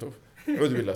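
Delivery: ordinary delivery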